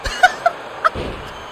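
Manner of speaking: breathy falsetto wheeze; a silent squealing laugh; high squeaky laugh. Real, not acted. Gamer laughing at a botched pin, squeaky high laugh.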